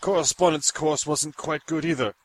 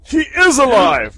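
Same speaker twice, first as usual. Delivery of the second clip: higher and louder, thrilled; excited shout; 8 semitones higher, 7 dB louder than usual. Acted. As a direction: exclaim in amazement. Creation speaks.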